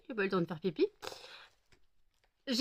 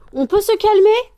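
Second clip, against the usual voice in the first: higher, louder plea for calm; loud, high "can we calm down?"; loud exasperated call; 10 semitones higher, 14 dB louder than usual. Real, not acted. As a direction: call out over the fight, exasperated. Pets fighting on her.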